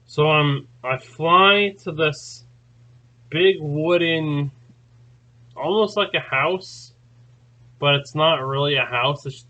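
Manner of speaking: normal talking voice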